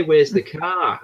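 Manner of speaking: mock New Zealand pronunciation of "car"; New Zealand accent. Shown as a demonstration. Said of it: "they say".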